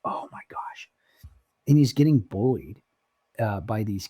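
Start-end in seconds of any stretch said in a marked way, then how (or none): 0.1-0.9 softer hushed whisper; unvoiced shocked whisper. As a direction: breathe it out in disbelief. Stunned reaction to bullying video.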